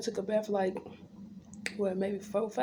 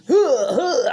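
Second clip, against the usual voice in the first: mock nurse gasps; 4 semitones higher, 8 dB louder than usual. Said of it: "that motherfucker said".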